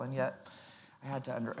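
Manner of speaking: ordinary delivery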